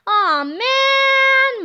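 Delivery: high, loud drawn-out groan of letdown; disappointed moan. A narrator's reading. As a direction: moan like a let-down crowd. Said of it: "moaned the crowd of people".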